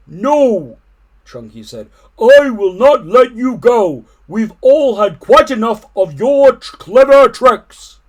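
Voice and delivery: loud, high, slow stern bellow; elephant refusing. A narrator's reading. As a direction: put your foot down, booming.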